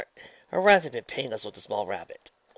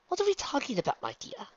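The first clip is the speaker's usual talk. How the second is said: sensuous tease. A narrator's reading. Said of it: "adding a low, sensuous tone to her voice".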